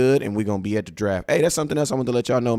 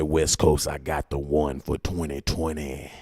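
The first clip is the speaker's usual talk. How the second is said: slow low imitation of a friend; 8 semitones lower, 6 dB quieter, slower than usual. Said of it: "in that Starboy mode".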